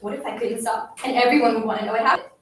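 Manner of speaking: plain reading voice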